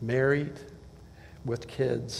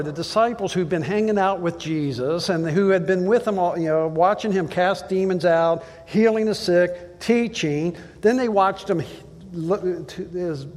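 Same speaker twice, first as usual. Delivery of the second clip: ordinary talk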